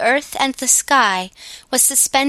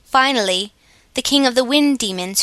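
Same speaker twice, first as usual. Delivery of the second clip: ordinary delivery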